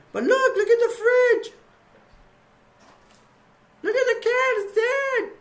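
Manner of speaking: very high, loud mock shriek; hammed-up screaming panic. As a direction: shriek it out in overdone horror. Megan screaming about dead cat.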